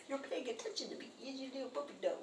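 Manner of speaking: very high, hushed puppy talk; talking to a dog. Retold or imitated. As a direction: coo softly at the dog in a tiny voice.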